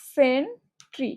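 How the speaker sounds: "ডিসেন্ট্রি" very slowly, di, sen, tri apart; syllable by syllable. Shown as a demonstration. Teacher breaking the word down for spelling, syllable by syllable. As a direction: pause after each syllable of "ডিসেন্ট্রি". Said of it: "ডিসেন্ট্রি বানানটা কিরকম হয়".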